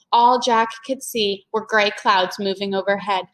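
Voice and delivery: plain reading voice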